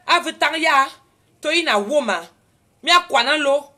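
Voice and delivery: loud, high indignant rebuke; angry outburst. Retold or imitated. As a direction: tell him off at full volume. Angry retort at airport staff.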